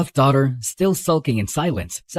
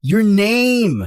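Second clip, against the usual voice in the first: loud and exasperated, pushing hard on name; loud demand for her name; yelling; 5 semitones higher, 4 dB louder than usual. Acted. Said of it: "Don't yell at me".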